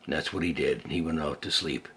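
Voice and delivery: ordinary delivery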